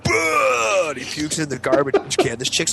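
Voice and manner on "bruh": high comic retch; imitated vomiting. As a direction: heave it out like sudden puke. Imitates Bailey throwing up.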